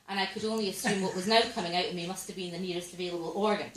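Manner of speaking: usual speaking voice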